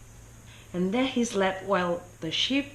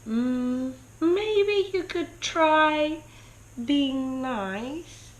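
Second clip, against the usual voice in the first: small high voice, slow and gentle; a tiny sheep offering advice timidly; little voice; 7 semitones higher, much slower than usual. Acted. Small sheep answering King Pig, high slow little voice. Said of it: "said a little voice".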